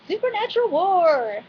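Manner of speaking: high, swooping battle announcement; playful high-pitched hype; mock-dramatic proclamation.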